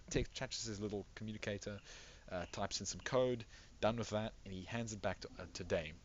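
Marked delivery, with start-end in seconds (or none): none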